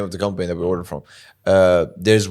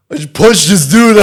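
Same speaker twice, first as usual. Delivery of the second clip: higher, louder hammed-up voice, laughing; mock tough guy; 7 semitones higher, 9 dB louder than usual. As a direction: play a silly roided-up girlfriend. Pretend testosterone side effect.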